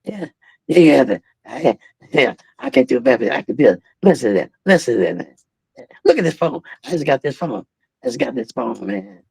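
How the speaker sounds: imitating Ali's quiet private voice; soft-spoken Ali. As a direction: murmur casually like a gentle champ. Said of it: "he spoke like this, man".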